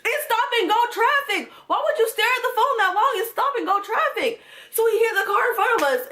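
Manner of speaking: high, indignant shouting rant; exasperated rant. Real, not acted. Scolding the ex for texting in traffic, high exasperated rant. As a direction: vent at him for staring at his phone. Ranting at his driving.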